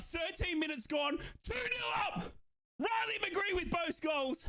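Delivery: high, drawn-out commentator's shout; triumphant goal call. Real, not acted. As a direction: call the score out high and slow. Announcing second goal.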